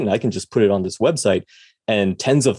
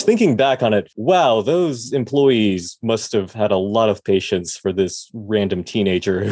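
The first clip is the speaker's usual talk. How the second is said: ordinary delivery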